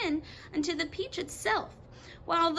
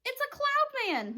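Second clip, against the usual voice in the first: frightened cry; 13 semitones higher than usual. Acted. Miss Spider spotting a cloud man, frightened high cry. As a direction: cry it out in sudden fear. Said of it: "Miss Spider cried".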